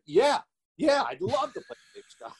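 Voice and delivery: high-pitched, re-enacted excited yes; eager acceptance. Actor accepts the role over the phone, high excited re-enactment. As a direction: jump at the offer, voice way up.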